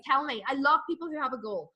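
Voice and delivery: ordinary talk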